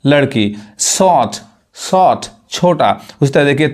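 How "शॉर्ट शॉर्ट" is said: शॉर्ट as "sawt", no r; silent r. Shown as a demonstration. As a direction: drop the r in शॉर्ट. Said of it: "आर नहीं पढ़ाता है".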